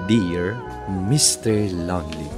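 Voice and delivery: normal talking voice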